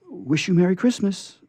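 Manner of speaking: put-on high, eager little girl; bright child's greeting. Betsy greeting the scarecrow, high and eager. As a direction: call out a happy Christmas wish.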